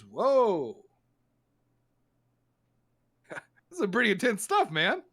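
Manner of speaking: a tripping doctor drawling in wonder; dazed doctor. Acted. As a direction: drift off like he's just had a hit. Doctor high on the potion.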